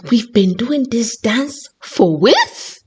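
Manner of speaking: slow, loud disbelief, stretched out; incredulous outburst. Real, not acted. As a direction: draw it out loudly in indignant disbelief. Indignant at a late love confession.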